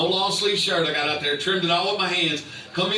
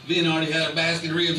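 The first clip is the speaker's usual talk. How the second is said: normal talking voice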